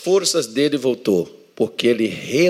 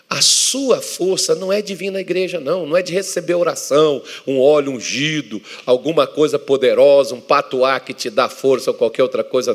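climactic preaching; 5 semitones higher, 4 dB louder than usual. Acted. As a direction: drive the point home to the flock.

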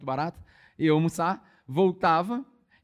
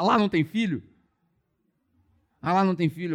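shocked objection; 3 semitones higher, 5 dB louder than usual. Retold or imitated. Acts out her shocked objection.

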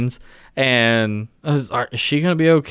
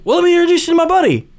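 eager host voice; 14 semitones higher, faster than usual. Retold or imitated. Acting out character's introduction.

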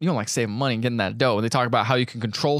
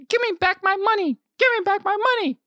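put-on high, far-off whining kid; kid yelling. Boy demanding his money from the banker, high mock yell. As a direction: demand the money back like a wronged child. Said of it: "the kid starts yelling".

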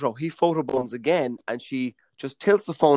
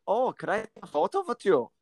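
lightly voiced Swedish tourist girl; a faint bad Swedish lilt on the question; worst Swedish accent; 5 semitones higher, 5 dB quieter than usual. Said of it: "Worst Swedish accent ever".

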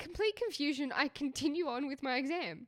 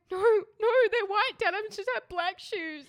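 high, loud whiny pleading; upset protest; 8 semitones higher, 7 dB louder than usual. Retold or imitated. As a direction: imitate a tearful kid refusing. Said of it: "And I started, like, crying".

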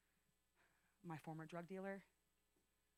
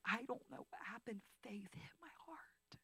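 awed whisper; 4 semitones higher, 5 dB quieter than usual. Real, not acted. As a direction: hush it as holy awe. Repeating the faith moment.

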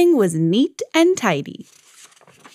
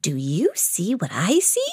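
whispering; 4 semitones lower, 5 dB quieter than usual. Acted. Whispered question in story.

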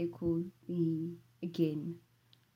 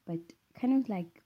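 ordinary talk

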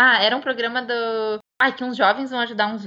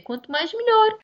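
imitating a Portuguese accent, pitch climbing high on melhor; 11 semitones higher than usual. Shown as a demonstration. Brazilian friend showing off her Portuguese accent. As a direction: put on a mock European Portuguese accent. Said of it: "é a frase que eu vou dizer".

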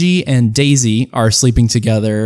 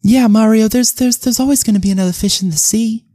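much higher, cheery consoling princess; Daisy voice; 9 semitones higher than usual. Acted. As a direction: comfort him brightly as Daisy.

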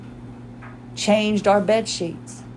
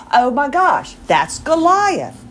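high, louder burst with a drawn-out name; excited recognition; 8 semitones higher, 5 dB louder, slower than usual. Retold or imitated. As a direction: gush as you spot a childhood favourite.

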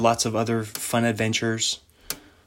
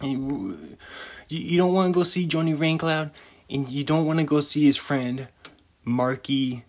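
higher-pitched mock Italian mobster; 4 semitones higher than usual. Mobster threatening a visit to his friend Johnny Raincloud, mock Italian accent. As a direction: improvise a hammed-up Italian mobster warning. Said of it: "that's my Italian".

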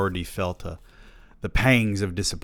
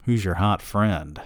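usual speaking voice

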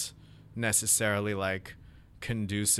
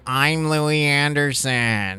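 slow, drawn-out comic impression, pitched up and louder; Louis Anderson; 5 semitones higher, 5 dB louder, much slower than usual. Shown as a demonstration. Comic showing the podcast co-host a celebrity impression, slow and high. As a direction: stretch every word out in a higher, louder put-on voice. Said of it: "maybe I can do".

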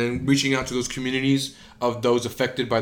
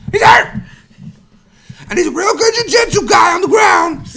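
much higher shouted commentator call; Joe Rogan MMA call; 10 dB louder than usual. Retold or imitated. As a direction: scream it ringside. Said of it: "it's too high at the end".

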